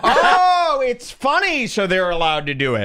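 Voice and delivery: loud high "oh", then mocking echo; laughing, exaggerated mock understanding; sarcastic realization. Real, not acted. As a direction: throw their excuse back at them. Sarcastic echo of clip's excuse.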